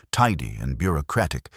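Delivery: ordinary delivery, nothing marked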